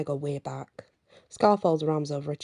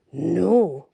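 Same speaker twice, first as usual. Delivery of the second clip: louder, firm growl; growled refusal; 7 dB louder than usual. A narrator's reading. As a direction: shut it down hard. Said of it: "Nox growls".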